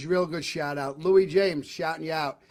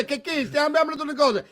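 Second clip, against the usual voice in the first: fake Italian; 8 semitones higher than usual. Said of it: "She doesn't fake Italian words".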